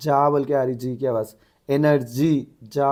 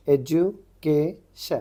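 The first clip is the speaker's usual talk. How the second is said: Education very slowly, syllable by syllable; broken into syllables; much slower than usual. Shown as a demonstration. Teacher reads out a transcription, education syllable by syllable. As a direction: sound out education in separate parts, ending in शन. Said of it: "S N आ गया तो उसको शन बोलते".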